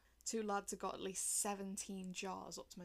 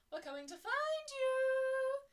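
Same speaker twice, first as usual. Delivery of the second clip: sing-song taunt; 9 dB louder, slower than usual. Acted. Romans calling out.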